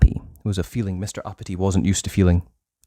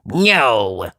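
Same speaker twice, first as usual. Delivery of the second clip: rude. Acted. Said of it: "said Mister Uppity rudely".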